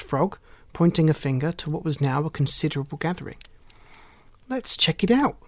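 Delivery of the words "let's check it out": high, keen suggestion; bright-eyed eagerness, higher voice; eager. A narrator's reading. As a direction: pitch it up with eagerness. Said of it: "said Rosie eagerly".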